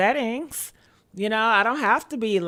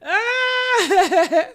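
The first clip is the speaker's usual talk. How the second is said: high squealing laugh; 8 semitones higher than usual. Real, not acted. Streamer cracks up at own ratchetness, high squealing laugh.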